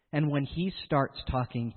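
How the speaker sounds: ordinary delivery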